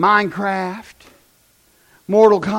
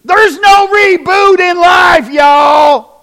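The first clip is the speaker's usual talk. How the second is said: slow, loud, higher shout to the crowd; preacher's shout; 9 semitones higher, 10 dB louder, much slower than usual. Real, not acted. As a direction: hammer it home to the congregation. Preacher's climax.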